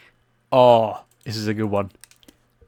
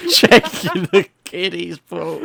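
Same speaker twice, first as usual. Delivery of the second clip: slow, louder, higher laughing echo; laughing repeat; 6 semitones higher, 3 dB louder, much slower than usual. Acted. Friend echoing the kiddies pool joke, laughing. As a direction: savour the line through laughter.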